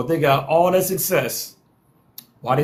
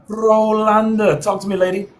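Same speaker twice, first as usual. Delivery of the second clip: calling out a guest; 4 semitones higher, 3 dB louder than usual. Real, not acted. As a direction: sing out her name to bring her in. Host calls on panelist.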